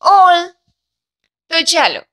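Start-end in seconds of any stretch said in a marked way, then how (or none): 0.0-0.4 ઓલ said loud and drawn as a phonics sound; teacher sounding out ઓલ loudly for the class. Shown as a demonstration. Sounding out ઓલ loudly for students learning phonics. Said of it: "A, double L".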